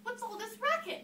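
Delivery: a touch of annoyance; crying out. Acted. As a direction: call out, put out by noise. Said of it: "Cindy cried".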